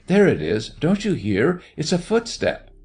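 high, urgent alarm. A narrator's reading. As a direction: raise the pitch as if hearing danger close by. Frightened woman hears a footstep.

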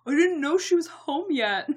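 Josh's bit. Retold or imitated. Josh voiced in a retold scene.

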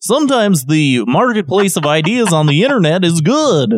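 hammed-up talk-show pundit, sing-song and emphatic; Dave Rubin. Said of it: "get into my Dave Rubin voice here".

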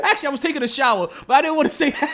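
laughing through the confession. Real, not acted. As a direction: chuckle while admitting it.

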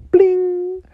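high sung chime imitation; chime sound. Shown as a demonstration. Imitating the page-turn chime for kids, high and ringing. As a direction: ring it like a bell. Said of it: "hear the chimes ring like this".

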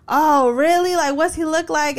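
imitating startled store workers; mock freaking out. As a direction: play the ladies' panic, hammed-up. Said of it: "the two ladies freak out".

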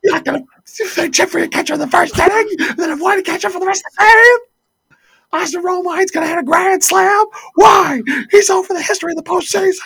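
high, loud, fast furious rant; angry rant. Retold or imitated. Co-host ranting at the caller, high, loud and fast. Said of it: "And he's like".

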